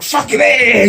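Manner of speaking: excited cheer. Retold or imitated. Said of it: "And I'm like".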